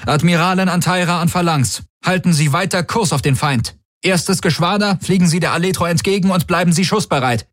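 high-pitched military orders; fleet commander. A narrator's reading. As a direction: issue battle orders over the comm, pitched high. (Flottenkommando, hoch angesetzte Befehle)

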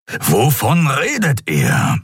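slow, drawn-out gruff question; grumbling Keru. Acted. Keru asks what they are talking about, grumbling. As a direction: grumble it, stretching the words. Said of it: "brummte Keru".

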